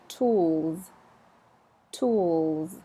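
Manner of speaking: tools with a soft buzzing z at the end; soft final Z. Shown as a demonstration. Teacher modelling tools for learners, soft z. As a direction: let the z of tools glide softly. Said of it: "that little soft Z".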